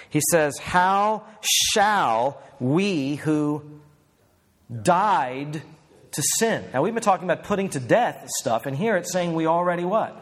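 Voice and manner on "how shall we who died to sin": very slow, each word held apart; slow emphatic reading. Shown as a demonstration. Preacher rereading the verse to the congregation, very slow. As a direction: linger on died, let it land. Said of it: "don't read it maybe the way you've read it before".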